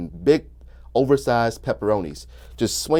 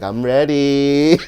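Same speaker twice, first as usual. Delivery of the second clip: slow seductive; 2 semitones higher, 6 dB louder, much slower than usual. Retold or imitated. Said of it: "she was just gonna be like".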